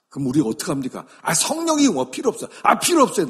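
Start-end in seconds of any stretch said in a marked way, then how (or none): 1.2-3.3 loud, high-pitched brush-off; acted defiance. Acted rejection of the Spirit.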